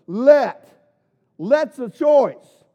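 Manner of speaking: Let. punched out loud and alone; booming emphasis on Let; stress on Let. Real, not acted. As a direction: hit the word Let hard, then slow down. Preacher stresses the word Let.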